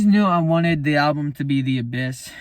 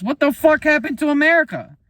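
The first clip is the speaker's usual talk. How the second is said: much higher and louder, shouting the question; outraged shout; 12 semitones higher, 6 dB louder than usual. Real, not acted. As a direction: yell it out in disbelief. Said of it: "I even have a track called".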